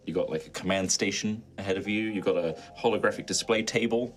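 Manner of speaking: usual speaking voice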